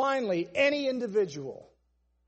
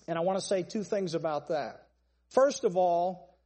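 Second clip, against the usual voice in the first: normal talking voice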